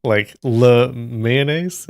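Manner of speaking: le mayonnaise drawn out slowly in mock French. Shown as a demonstration. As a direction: savour le mayonnaise like a snooty French word. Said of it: "which is".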